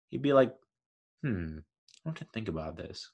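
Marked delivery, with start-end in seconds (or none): 1.2-3.1 low, murmured dumb dog; dim dog voice. Pretend. Voicing a dim dog thinking.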